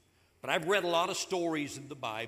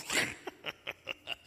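hidden breathy laugh; 5 semitones lower than usual. Retold or imitated. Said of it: "Sarah's behind the tent going".

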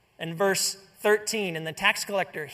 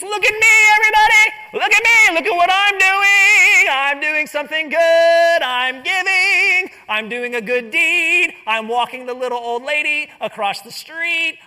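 hammed-up self-promotion cry; attention-seeking show-off; 8 semitones higher, 12 dB louder than usual. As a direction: demand everyone's attention. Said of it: "we live in a world where it's".

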